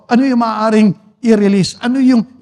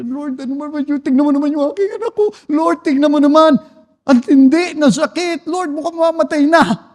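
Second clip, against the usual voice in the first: high-pitched begging, acted out; pleading worried parent; 6 semitones higher than usual. Preacher mimics a worried believer praying for a sick child, pleading. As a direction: plead helplessly, pitched well up. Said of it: "Pero nasasabi".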